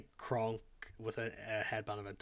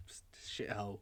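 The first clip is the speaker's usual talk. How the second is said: muttered aside; 3 dB quieter, much faster than usual. Acted. Shopkeeper mutters about her hometown.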